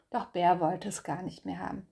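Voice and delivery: plain reading voice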